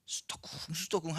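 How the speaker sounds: soft, hushed mumble; imitated gossiping. Church members gossiping behind someone's back, hushed mock murmur. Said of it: "이러면서 뒤에서 막".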